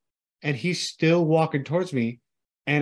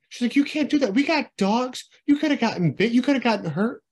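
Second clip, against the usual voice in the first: mock scolding mom; 7 semitones higher than usual. Voicing mom scolding him.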